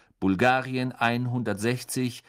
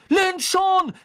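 very high, loud cry; shouting; 6 dB louder than usual. A narrator's reading. Harry watches Lynch about to crash, high shout. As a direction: call it out urgently over the crowd. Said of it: "rief Harry".

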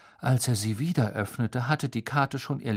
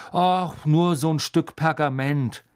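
put-on higher, louder boy's voice; forced casualness; 5 semitones higher, 6 dB louder than usual. Harry pretending the map is nothing to Snape. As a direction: play it down too eagerly.

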